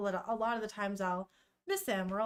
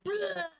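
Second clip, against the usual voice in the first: retching bleh; 10 semitones higher than usual. Retold or imitated. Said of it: "He goes".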